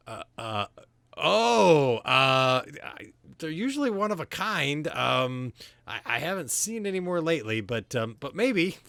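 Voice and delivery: high, loud panicked stammering; flustered stammer. Acted. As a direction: scramble for a lie in a panic. NPC flustered by awkward question.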